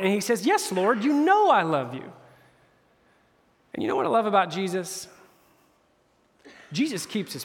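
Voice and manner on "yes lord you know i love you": higher-pitched, mildly exasperated reply; a little annoyed. Retold or imitated. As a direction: insist as an annoyed disciple, imitated. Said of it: "Peter's a little bit of annoyed".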